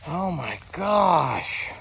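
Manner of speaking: slow, stunned awe; awed. Real, not acted. Vlogger reading a buried soldier's name, stunned and slow. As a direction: take it in slowly.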